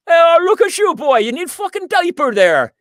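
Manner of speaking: loud, high mocking Irish brogue; Irish accent. Irish bartender mocking wet pants, loud high Irish brogue. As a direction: bark it like an Irish bartender teasing a mate. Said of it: "the one dude goes".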